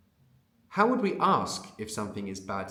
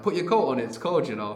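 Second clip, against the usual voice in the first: exclamatory urging; 3 semitones higher, 6 dB louder than usual. Pretend. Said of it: "the one I'm imagining".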